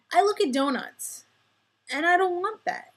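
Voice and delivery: emphatic rant. Real, not acted. Ranting about donuts.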